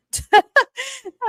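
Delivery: loud high-pitched laugh; a real squealing laugh at the chat; high burst of laughter. Real, not acted. Reacting to chat comment.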